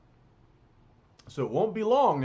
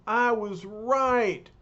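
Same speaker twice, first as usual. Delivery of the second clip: louder, slow angry yell; hollering Thing; 8 semitones higher, 5 dB louder, slower than usual. Acted. The Thing shouts, jealous of the surfer. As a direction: yell it slowly at the rival. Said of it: "hollers the Thing".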